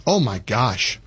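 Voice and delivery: ordinary talk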